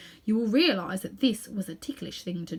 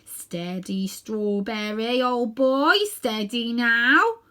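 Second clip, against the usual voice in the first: slow, drawn-out calming words; gentle the frightened horse; soothing a horse; 4 dB louder, much slower than usual. Acted. Cabby calming his frightened horse, slow and soothing. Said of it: "with the words".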